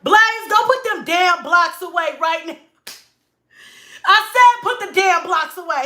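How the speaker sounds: loud, high shouted order; acted full-volume command, out of patience; angry mom yell. Mom yelling at Blaze over his blocks, angry shouting.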